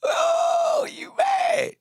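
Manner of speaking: squeaky high mock "oh, you mad"; mocking taunt. Streamer mocking a hater in chat, squealing high taunt. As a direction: taunt them in a shrill falsetto.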